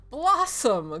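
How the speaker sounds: loud, high delighted exclamation; crying out. Acted. Priest repeats the little girl's name, crying out. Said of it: "cries the priest".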